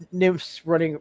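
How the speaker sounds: ordinary talk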